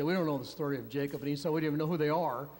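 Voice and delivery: low, quick protest of ignorance; mock clueless family. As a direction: rattle it off low, like a baffled family. Family balks at therapist's question.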